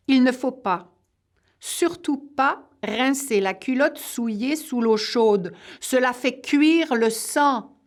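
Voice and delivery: quoted warning. A narrator's reading. Two sisters' saying about washing soiled underwear, emphatic warning. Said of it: "qu'elles disent".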